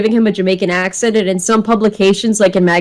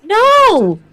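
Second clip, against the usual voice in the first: incredulous no; 11 semitones higher than usual. Real, not acted. Indignant reply to absurd request.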